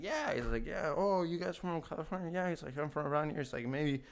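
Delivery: a hint of an eager drifter; homeless guy.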